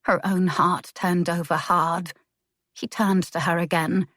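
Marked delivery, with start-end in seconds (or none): none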